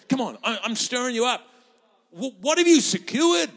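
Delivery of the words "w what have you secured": normal talking voice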